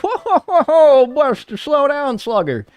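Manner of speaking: a squeaky panicked "whoa, Buster"; mock Google alarm. Google stopping a rope buyer, squeaky high alarmed voice. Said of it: "And Google's like".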